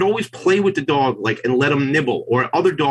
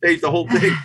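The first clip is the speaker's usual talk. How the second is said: laughing mock demand; 4 semitones higher than usual. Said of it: "she's like".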